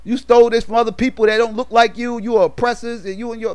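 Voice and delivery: high, heated retold tirade; going in on her. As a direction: lay into her, pitch climbing high. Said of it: "he started going in".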